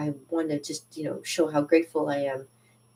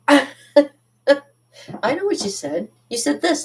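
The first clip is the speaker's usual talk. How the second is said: higher, slow, teasing voice; knowing retort; 9 semitones higher, 5 dB louder, much slower than usual. Retold or imitated. Telling a French coworker she was understood, slow and teasing. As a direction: drag it out, playfully knowing. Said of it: "I said".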